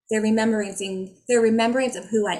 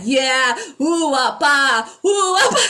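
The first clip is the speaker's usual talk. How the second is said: loud, higher playful chant of sounds; spirit guides cheering; 4 semitones higher, 8 dB louder, slower than usual. Pretend. Her guides cheering her on in a loud playful chant. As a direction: chant like gleeful spirit helpers. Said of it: "my guys are still here and they're like".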